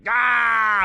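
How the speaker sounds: loud, high "ah" yell; mock yell. Cohost guessing how the gym guys screamed, mock yell. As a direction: imitate a guy yelling through his workout. Imitating screaming men working out.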